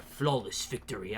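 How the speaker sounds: low, hushed victory declaration; Kung Lao. Retold or imitated. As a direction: drop low to announce a game win. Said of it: "Kung Lao's like".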